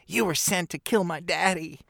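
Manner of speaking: lightly voiced angry daughter; mock accusing Jyn. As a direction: play up the betrayed daughter. Quoting Jyn in the film.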